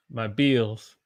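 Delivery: "bills" said as "beels"; Southern "bills", rhyming with eels; Southern held vowel. Shown as a demonstration. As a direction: hold a long ee in "bills". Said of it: "So I hold it".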